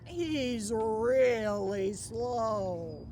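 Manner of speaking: slow it down like a flat tyre. Acted. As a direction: drag the words out slowly. Story line about slow car.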